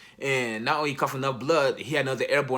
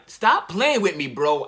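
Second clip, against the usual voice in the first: raised, slowed pleading cry; half-shouted denial of the news; loud, slow, stunned refusal; shocked disbelief; 2 semitones higher, 5 dB louder, slower than usual. Retold or imitated. Told dad has died.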